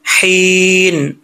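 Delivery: very slow हीन with thin h from the throat. Shown as a demonstration. Teacher drilling the letter, drawn-out recitation. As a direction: breathe the h, then hold हीन long. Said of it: "हल्क से है".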